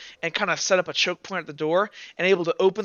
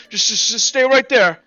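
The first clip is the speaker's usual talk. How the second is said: loud, higher-pitched stammered command; guard's order; 6 semitones higher, 9 dB louder than usual. Acted. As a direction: call out like a guard halting strangers. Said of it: "and they go".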